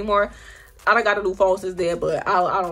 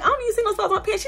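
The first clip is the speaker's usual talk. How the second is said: tag; 10 semitones higher, much faster than usual. Retold or imitated. Retold exchange in put-on voice.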